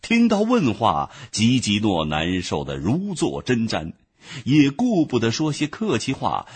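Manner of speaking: plain reading voice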